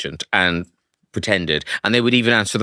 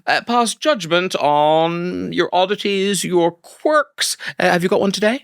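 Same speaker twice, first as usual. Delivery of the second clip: segment announcer; 5 semitones higher than usual. Acted. Introduces the podcast segment.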